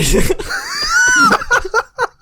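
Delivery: very high, loud burst of laughter; loud, helpless cackle; real laughter. Real, not acted. Cracking up after own remark.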